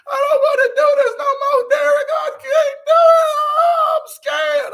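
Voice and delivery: high, loud mock crying wail; hammed-up scared crying falsetto; imitating Monique crying. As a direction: squeal it like a terrified woman sobbing in the car. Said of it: "She's like".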